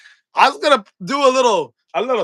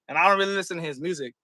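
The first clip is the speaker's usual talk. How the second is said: ordinary delivery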